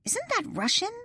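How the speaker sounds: high, startled question; lightly voiced girlfriend's disbelief; surprised question. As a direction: jump up in pitch, caught off guard. Lily questions a Russian name.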